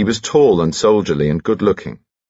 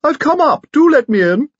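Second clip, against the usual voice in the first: very high, louder piping call from outside the door; extraordinarily high-pitched voice; 6 dB louder than usual. Acted. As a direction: pipe it up in a tiny falsetto. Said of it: "an extraordinarily high-pitched voice".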